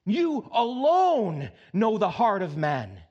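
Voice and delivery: slow pleading prayer to God; earnest prayer. Retold or imitated. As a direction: cry it out as a humble plea. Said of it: "when he cries out to God".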